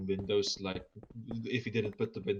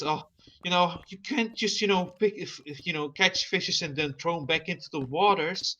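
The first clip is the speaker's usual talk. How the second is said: preachy Becky; 7 semitones higher than usual. Retold or imitated. Young woman lecturing fishermen about fish, preachy. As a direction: scold the fishermen like a know-it-all.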